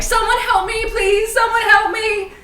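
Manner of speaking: high, loud cry for help; calling for help. Acted. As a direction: shout desperately for help. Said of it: "I'm like".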